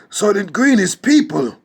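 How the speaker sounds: high, loud movie-hero cry; hammed-up cry of horrified revelation; Charlton Heston. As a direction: shout it as a melodramatic film impression. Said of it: "Let me do my Charlton Heston".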